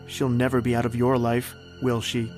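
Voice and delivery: usual speaking voice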